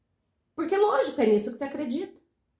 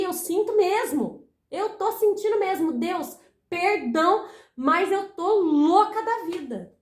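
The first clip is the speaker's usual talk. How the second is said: slow, higher-pitched acted plea; drawn-out, dramatised admission to God; heartfelt confession; 4 semitones higher than usual. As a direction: confess it emphatically, stretching the words.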